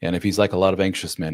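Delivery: ordinary talk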